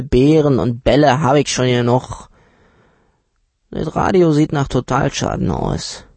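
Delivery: put-on a know-it-all dealer lowballing the children; uncle's voice. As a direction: play the condescending uncle talking down to the kids. Narrator voices the haggling uncle.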